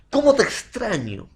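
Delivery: "Cómo te extraño" high-pitched, as an exclamation. Shown as a demonstration. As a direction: exclaim the feeling, lifting the pitch. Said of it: "Estoy expresando un sentimiento".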